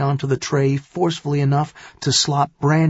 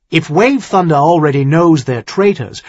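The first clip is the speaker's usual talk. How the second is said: loud, heated, raised question; harsh retort; 6 semitones higher, 8 dB louder, faster than usual. Acted. Son-in-law disputes what he could add, harsh and loud. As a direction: argue back harshly, voice high and loud. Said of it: "Tyrion asked harshly".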